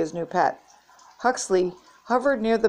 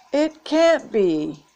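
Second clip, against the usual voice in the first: high, drawn-out astonishment; stunned disbelief; 7 semitones higher, 4 dB louder, much slower than usual. A narrator's reading. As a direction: stretch each word in shocked disbelief. Nina sees a shocking sign.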